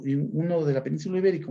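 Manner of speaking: normal talking voice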